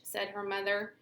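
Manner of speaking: plain reading voice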